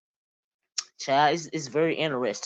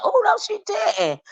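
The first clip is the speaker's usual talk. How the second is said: much higher, shrieked oh no she didn't; mock outrage; 3 dB louder than usual. Reacting to a brutal post, mock shriek of shock. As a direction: squeal it, scandalized. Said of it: "I was like".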